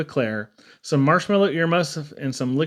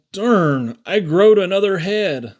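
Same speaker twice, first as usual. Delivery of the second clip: put-on dismayed kid; 4 semitones higher, 5 dB louder, slower than usual. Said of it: "Chester comes to school and said".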